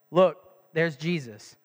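low, slow and deadpan; bored monotone announcement; flat, unexcited. Shown as a demonstration. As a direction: read it like it's no big deal. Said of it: "you kinda think that he reads it like".

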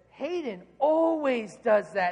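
put-on slow, drawn-out tattling child; chirping daughter. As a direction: imitate a little girl telling on her brother. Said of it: "my daughter then chirps in".

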